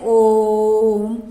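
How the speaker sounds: ओम and Hariom held long and slow; slow, long demo of the prolonged vowel in ओम; drawn-out ओम. Shown as a demonstration. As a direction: stretch ओम out to show a prolonged vowel. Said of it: "ओम का चिन्ह".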